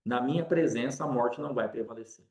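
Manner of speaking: Jesus telling her gently to come out; quiet voice of Jesus. Acted. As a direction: speak as Jesus, softly reassuring. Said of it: "E Jesus fala".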